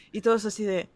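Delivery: ordinary talk, nothing marked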